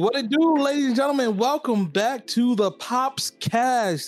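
energetic stream intro. Acted. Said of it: "I was about to do my YouTube intro".

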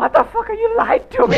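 high, loud outraged yell at a friend; mock furious yell. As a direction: shout it like you've been betrayed. Retold outburst at friend.

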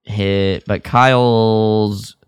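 normal talking voice

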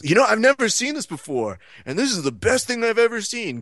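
high-pitched newcomer excitement, imitated; all invested. First-time fight watcher suddenly invested, mock-excited. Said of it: "now he's all invested".

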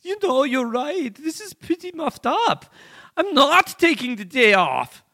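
put-on high, loud German accent. Kim Dot Com answering the hackers in a German accent. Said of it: "a German accent".

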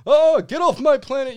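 high, loud outraged alien yell; hammed-up alien shouting; angry alien. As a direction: chase the lady off like an offended alien. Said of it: "He's like".

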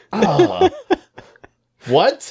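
hammed-up horror; high mock outrage; mock shock. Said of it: "you're like".

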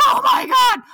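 high, loud mock terror; panicked outburst. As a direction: shout it as if the car is flying. Retells panic in speeding car.

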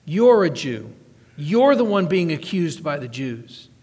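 normal talking voice

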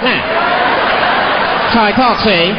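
high, drawn-out gangster voice; forties bad guy. Insisting this is his talk, in forties gangster voice. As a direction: stretch it like an old-movie crook. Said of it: "that's how bad guys used to talk".

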